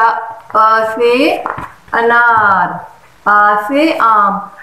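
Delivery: slow, loud, stretched "अ से अनार, आ से आम"; teaching recitation. Shown as a demonstration. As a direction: draw out "अनार" and "आम" for a child. Demonstrates teaching letters to child.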